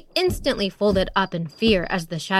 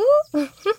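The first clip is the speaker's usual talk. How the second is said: high giggle; 13 semitones higher, 3 dB louder than usual. Acted. Porcupine laughs.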